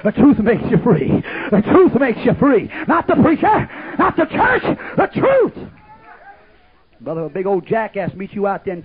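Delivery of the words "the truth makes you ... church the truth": rising, louder preacher's cry, climbing each phrase; full-force preaching. Acted. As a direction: preach it with mounting fire. Preacher building to a peak.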